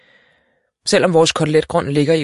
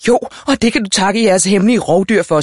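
high, loud accusing retort; indignant mother's outburst; angry blaming; 6 semitones higher, 5 dB louder than usual. A narrator's reading. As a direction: throw the blame at them, exasperated. Mother blames children for early labour.

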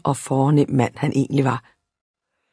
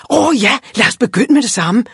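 put-on high, louder girl's exclamation; eager shout; 6 semitones higher, 4 dB louder than usual. A narrator's reading. Red-haired girl urging the man to begin demolishing, shouting eagerly. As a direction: burst out, pitched well up. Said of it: "råbte den rødhårede pige".

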